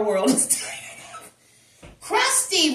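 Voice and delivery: exasperated line cracked open by laughter; laughing while talking. Real, not acted. Tarot reader venting to viewers about a tiresome energy, laughing. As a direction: break into a laugh mid-sentence.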